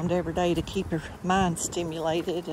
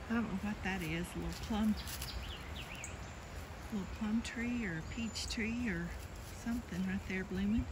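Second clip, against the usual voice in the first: quiet; 2 semitones higher, 14 dB quieter than usual. Real, not acted. Admiring a blooming tree quietly.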